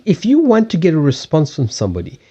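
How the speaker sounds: ordinary talk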